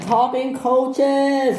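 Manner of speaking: high, slow drawn-out shout; startled long shout. Acted. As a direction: cry it out in alarm. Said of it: "he shouted".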